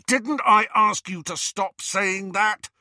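high, loud, slowed rebuke; indignant telling-off; angry interruption. Acted. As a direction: cut in with exasperated force. Ron cuts off the name.

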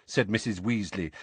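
ordinary delivery

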